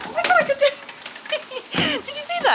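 very high, delighted squeal with a laugh; thrilled squeal. Real, not acted. Mum skipping on camera squeals at her own trick, delighted. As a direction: squeal with glee at landing the trick.

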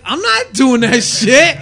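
high, loud, drawn-out protest; indignant refusal. Retold or imitated. Wrestler rejecting the bailiff gimmick, loud indignant refusal. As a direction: refuse loudly, stretching it out.

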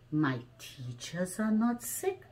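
ordinary delivery, nothing marked

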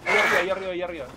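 loud alarmed shout; sudden loud warning; urgent call. Real, not acted. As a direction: yell out where the enemy is. Spots enemy above.

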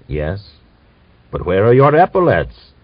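usual speaking voice

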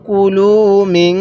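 long drawn-out chanted recitation, every vowel stretched; slow Quran recitation. Shown as a demonstration. Teacher models the verse for students, slow drawn chant. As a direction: intone it slowly, as the teacher's model reading. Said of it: "তাহলে".

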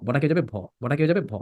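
usual speaking voice

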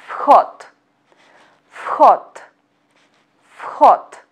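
vhod with the first letter said as f, fhod; Russian vhod. Shown as a demonstration. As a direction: vhod said slowly as fhot, final d as t. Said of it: "we pronounce".

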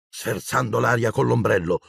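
plain reading voice

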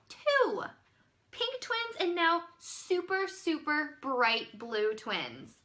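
usual speaking voice

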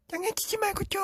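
put-on very high kid's voice; little brother begging his brother for tissue; little brother calling. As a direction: call out like a small child stuck on the toilet. Younger brother demands tissue.

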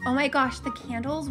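ordinary talk

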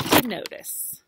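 plain reading voice

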